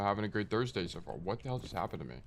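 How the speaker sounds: ordinary delivery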